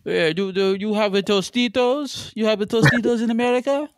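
cartoon Italian bragging about the best; mock Italian accent. Italian asks about Tostitos.

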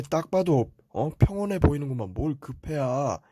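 ordinary talk

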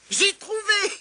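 high, loud exclamation; triumphant cry. A narrator's reading. Tirondin has solved the riddle, triumphant cry. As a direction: shout it out as a eureka. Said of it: "s'écria Tirondin".

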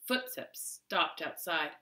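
plain reading voice

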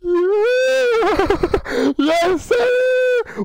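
high whooping yell of triumph; excited shout. Real, not acted. Streamer cheering his game wife on, excited shout. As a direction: shout it out with a laugh.

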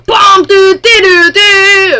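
loud high punching sound effects; shouted hit noises. Pretend. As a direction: yell out fight noises. Imitating game hits.

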